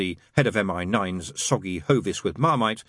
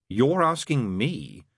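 slow, higher, mildly glum; 5 semitones higher, much slower than usual. A narrator's reading. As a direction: drag it out with understated gloom. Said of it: "said Crowley glumly".

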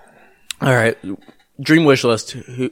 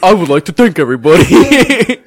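louder, higher parody of a professional announcer; mock radio voice; 4 semitones higher, 8 dB louder than usual. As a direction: mimic the host's formal sign-off, hammed-up. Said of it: "You just went into a really funny voice".

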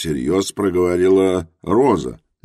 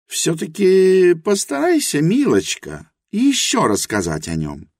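Helena's voice; 12 semitones higher than usual. A narrator's reading. Helena admits her ignorance to Rosa, high and earnest. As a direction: confess humbly in Helena's high voice.

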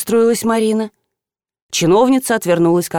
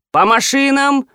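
raised, commanding call; команда; 6 semitones higher, slower than usual. A narrator's reading. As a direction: order the group to move. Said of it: "скомандовал Дегтярев". (командным голосом, повыше)

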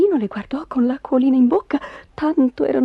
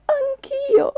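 high, tearful sigh; plaintive echo, pitched very high. A narrator's reading. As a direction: sigh it out wistfully.

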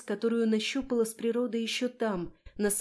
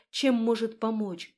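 plain reading voice

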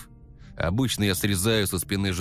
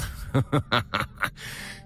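unvoiced sinister chuckle; breathy evil chuckle; 3 semitones higher than usual. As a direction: chuckle like a maniac. Maniac laugh.

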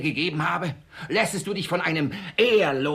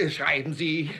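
ordinary talk, nothing marked

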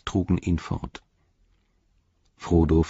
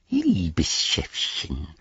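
slow hissing creature whisper; Gollum whisper; 3 dB quieter, much slower than usual. A narrator's reading. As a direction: creep it out like Gollum. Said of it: "flüsterte er". (Gollum-Flüstern)

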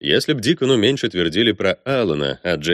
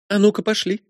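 brash princess voice; 13 semitones higher than usual. Acted. Matilda calling Robert away.

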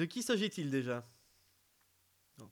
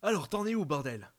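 impatient; 6 dB louder than usual. Shown as a demonstration. Impatient take of the line.

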